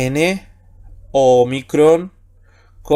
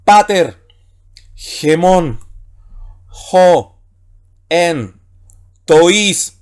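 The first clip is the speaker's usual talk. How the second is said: word-by-word Greek reading, with pauses; reading Greek aloud; 4 dB louder, much slower than usual. Shown as a demonstration. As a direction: read each Greek word out separately and firmly. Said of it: "Leo nuevamente las que ya vimos".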